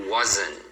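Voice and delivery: wasn't as a low "wozn", no T; English final N. Shown as a demonstration. Teacher repeating the example, wasn't ending on N. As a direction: finish wasn't on N, not nasal. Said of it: "com o som do N mesmo do inglês".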